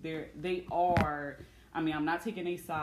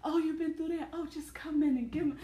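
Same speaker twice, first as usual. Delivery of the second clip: high, louder coddling coo; mock-doting, high-pitched fussing over someone; gushing savior; 10 semitones higher, 4 dB louder than usual. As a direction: gush with exaggerated sympathy, pitched way up. Acting out her savior self coddling men.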